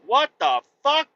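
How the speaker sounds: shocked high yelp; high startled cry of what the fuck. Retold or imitated. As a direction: jolt up in alarm at the snake. Startled by a snake above.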